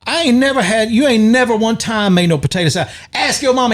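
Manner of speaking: high, exasperated mock man. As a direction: throw it back in disbelief. Retold Cops arrest argument.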